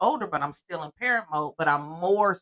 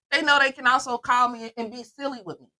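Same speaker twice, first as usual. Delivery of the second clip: ordinary delivery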